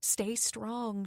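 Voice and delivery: ordinary delivery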